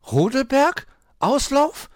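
high, loud, sharply rising; astonished questions. A narrator's reading. Magpie realises where she is walking, astonished. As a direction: echo each word in bewilderment, pitch shooting up. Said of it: "fragte die Elster außer Atem".

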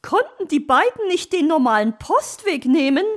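high, loud magpie protest; indignant complaint. Acted. Magpie complains about being scared, high indignant voice. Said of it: "beschwerte sich Frau Elster".

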